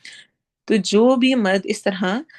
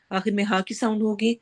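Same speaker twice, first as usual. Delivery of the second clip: ordinary talk, nothing marked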